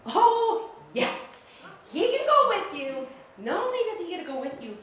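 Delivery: high, loud, bubbly yes with a laugh; gushing delight. Retold or imitated. Bosses pitching the circus job to Millie, high and gleeful. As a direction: sell the offer like it's too good to be true.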